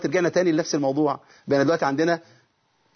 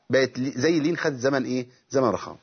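normal talking voice

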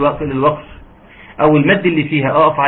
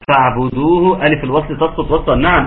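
usual speaking voice

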